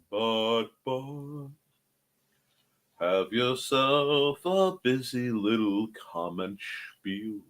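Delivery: soft, slow, half-sung murmur; hushed singsong. Pretend. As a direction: croon it under your breath.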